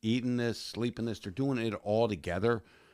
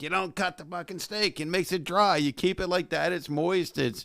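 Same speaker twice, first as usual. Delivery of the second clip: shop owner rant; 8 semitones higher than usual. Retold or imitated. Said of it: "he's like".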